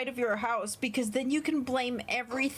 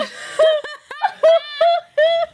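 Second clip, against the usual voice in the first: loud laugh; 14 semitones higher, 15 dB louder than usual. Real, not acted. Laughs at own joke.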